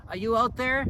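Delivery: loud and high, calling out into the night; yelling into the dark. Retold or imitated. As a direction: call out to someone you can't see. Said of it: "and he yells".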